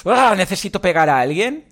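half-hearted fake rage; mock frustrated outburst. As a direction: play it like you're about to snap. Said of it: "Que estáis como".

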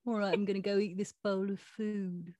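talking cat in a put-on British accent. Cat's video message to girl in hospital, mock British accent. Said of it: "I decided to give him a British accent".

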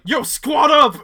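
high, loud hype call; frat bro. Retold or imitated. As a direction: bro yelling to the squad. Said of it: "Andrew was like".

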